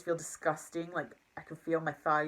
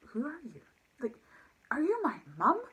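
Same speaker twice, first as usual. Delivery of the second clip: high pitched disbelieving sarcasm; incredulous retort; 8 semitones higher than usual. Retold or imitated. To her nagging manager, high mocking disbelief. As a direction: ask it like he's lost his mind.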